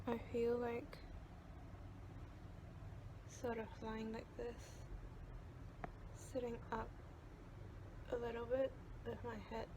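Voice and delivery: soft, careful talk through jaw pain; with a sore jaw. Real, not acted. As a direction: speak gingerly, it hurts to talk. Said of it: "It really hurts to talk right now".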